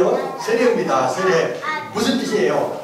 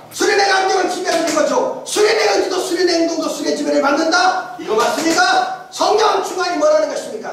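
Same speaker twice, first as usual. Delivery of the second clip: raised, high-pitched preaching cadence; emphatic preaching; 6 semitones higher than usual. Real, not acted. Preacher declares the Spirit governs everything, emphatic preaching. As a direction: drive each parallel clause home to the congregation. Preacher builds to doctrinal climax.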